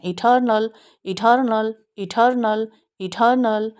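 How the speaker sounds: इटर्नल with a clear r after the vowel, American accent; American accent: pronounce the r in इटर्नल; American eternal. Shown as a demonstration. Teacher contrasting the American way for students, r sounded. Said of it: "Americans vowel sound के बाद आ sound को प्रोनाउंसिएट करते हैं".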